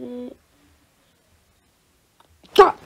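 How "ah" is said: short scream. Acted. Child screams at the dog.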